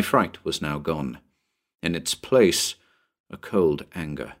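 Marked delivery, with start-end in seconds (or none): none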